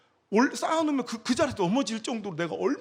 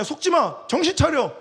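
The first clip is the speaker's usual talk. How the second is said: higher, louder commands to himself; loud self-scolding; 5 semitones higher, 5 dB louder than usual. Retold or imitated. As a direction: snap himself out of it. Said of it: "내가 나한테".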